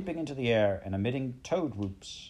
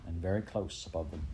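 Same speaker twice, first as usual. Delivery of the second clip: ordinary delivery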